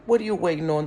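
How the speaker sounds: ordinary delivery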